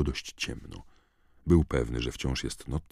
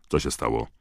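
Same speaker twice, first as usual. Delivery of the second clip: sharp tone; 3 semitones lower, 4 dB louder than usual. A narrator's reading. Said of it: "zapytał ostrym tonem".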